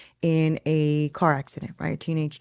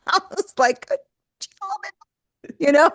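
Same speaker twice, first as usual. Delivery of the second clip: laughing; 9 semitones higher, 4 dB louder than usual. Real, not acted. Laughing over client's anger.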